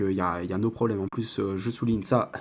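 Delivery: normal talking voice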